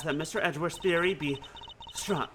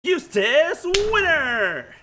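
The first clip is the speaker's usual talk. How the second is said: much higher, slow, drawn-out loud call; dramatic call; 12 semitones higher, 5 dB louder, much slower than usual. Acted. Announcing the missing prosecutor's entrance, drawn out and loud. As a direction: ring it out across the courtroom.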